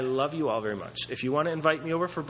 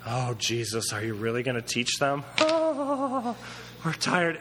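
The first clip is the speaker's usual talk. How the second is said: whiny tired disciples; 3 semitones lower than usual. Retold or imitated. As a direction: groan it like worn-out kids. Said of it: "disciples whining to Jesus".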